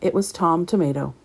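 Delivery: usual speaking voice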